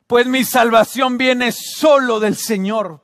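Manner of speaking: fervent preacher quoting scripture; declaimed scripture. Real, not acted. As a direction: proclaim the verse with conviction.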